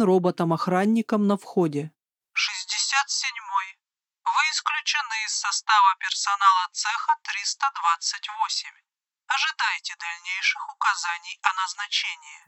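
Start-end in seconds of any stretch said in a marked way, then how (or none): 2.3-12.4 robot voice. Acted. Robot guard at the entrance.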